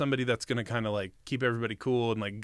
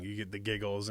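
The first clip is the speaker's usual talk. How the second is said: ordinary talk, nothing marked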